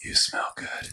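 breathy close whisper; creepy whisper. Shown as a demonstration. Showing the creepiest way a stranger says it, whispered. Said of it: "if they came up to you and whispered".

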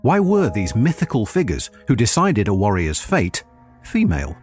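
ordinary talk, nothing marked

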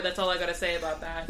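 quick, low paranoid mumble, acted out; hushed, hurried imitation of paranoia. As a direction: say it fast and under your breath, mock paranoid. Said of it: "Just, like, really paranoid".

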